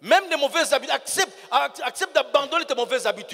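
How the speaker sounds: loud, driving sermon exhortation; preaching at full force. Acted. Preacher urges the congregation to drop bad habits, loud and forceful. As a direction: hammer it home to the congregation.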